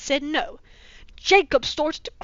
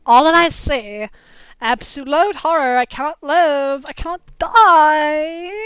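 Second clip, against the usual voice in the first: melodramatic lament; 3 semitones higher, 9 dB louder than usual. Acted.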